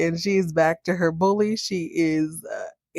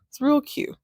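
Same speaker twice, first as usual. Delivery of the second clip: mocking cute; 8 semitones higher than usual. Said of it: "repeat, higher".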